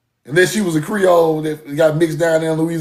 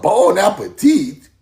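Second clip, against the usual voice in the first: mock French; slower than usual. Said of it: "We ain't no motherfucking Frenchmen".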